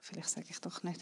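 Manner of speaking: breathy whisper, second thoughts; whispered aside. Real, not acted. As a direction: whisper it as if taking it back. Hesitant aside before a confession. (geflüstert, zögernd zurücknehmend)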